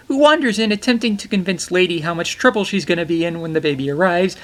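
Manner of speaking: usual speaking voice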